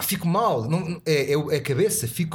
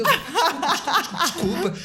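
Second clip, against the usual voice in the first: higher, hurried repeated apologies; mock apology; 11 semitones higher than usual. Imitating the shocked cyclist apologising, higher and hurried. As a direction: play the flustered cyclist saying sorry.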